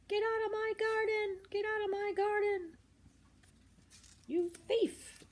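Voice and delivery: high, hammed-up angry shouting; angry farmer. Mr McGregor yelling at Peter, high-pitched. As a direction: chase the thief out of the garden. Farmer chasing rabbit from garden.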